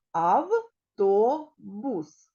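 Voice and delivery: автобус in three parts, ав, то, бус; slow teacher's ав-то-бус; syllable by syllable. Shown as a demonstration. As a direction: pause between each syllable of автобус. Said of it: "Тут три склади".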